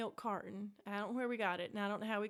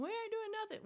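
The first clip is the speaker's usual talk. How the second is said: much higher, fake-innocent denial; kids' mock innocence; 12 semitones higher than usual. Kids lying to their father about the matches, high and innocent. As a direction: squeak out the lie.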